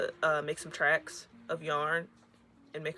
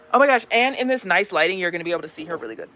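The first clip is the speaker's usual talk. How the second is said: louder, bursting with excitement, then rushing; excited gush; 10 dB louder, faster than usual. Real, not acted. Maker seeing her finished doll in good light, excited gush.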